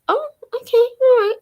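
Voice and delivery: mock surprise. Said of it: "And I was like".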